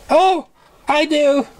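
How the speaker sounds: high delighted cry; surprised. A narrator's reading. Surprise thrilled at the bakery news. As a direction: gasp it out, thrilled. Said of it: "so surprised".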